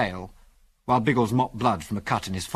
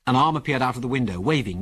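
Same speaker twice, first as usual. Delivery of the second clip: plain reading voice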